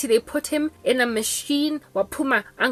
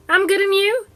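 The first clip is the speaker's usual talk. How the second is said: polite phone reply; 10 semitones higher, 6 dB louder than usual. Retold or imitated. Her own reply on the phone.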